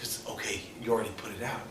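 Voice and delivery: very soft, low retold answer; quiet, understated admission; hushed reply. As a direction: say it under your breath. Old man's quiet reply in story.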